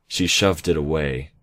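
ordinary delivery, nothing marked

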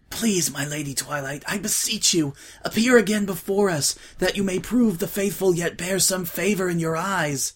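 high, begging supplication; pleading prayer. A narrator's reading. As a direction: beseech the goddess. Desperate prayer.

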